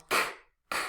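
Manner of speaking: a lone clipped "k"; just the bare "k" sound, short. Shown as a demonstration. Teacher isolating the K sound for students. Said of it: "the K sound".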